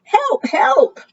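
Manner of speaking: very high, trumpeting cry for help; trumpeted cry. Acted. Frightened Elephant trumpets for help, very high and panicked. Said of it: "trumpeted Elephant".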